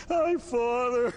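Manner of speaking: high, drawn-out groaning greeting; hammed-up injured voice; battered victim. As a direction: groan out the hello as if badly hurt. Battered Rob greets priest again.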